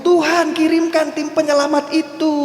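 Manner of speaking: high, loud cry for rescue from the roof; desperate shout. Retold or imitated. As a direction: shout to God in sudden panic. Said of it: "baru dia teriak". (teriakan panik minta tolong, tinggi dan keras)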